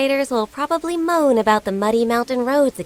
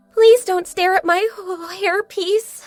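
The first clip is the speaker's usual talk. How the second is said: higher, embarrassed plea; self-conscious, pitched up, halting before the last word; shy, flustered request; 7 semitones higher than usual. Acted. Embarrassed request not to be stared at.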